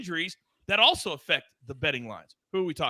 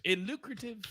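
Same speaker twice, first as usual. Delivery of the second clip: high, soft imitation of a co-host; hushed, high-pitched impression; Martin; 5 semitones higher, 11 dB quieter than usual. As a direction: mimic a colleague's pet word, soft and high. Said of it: "In Martin's voice".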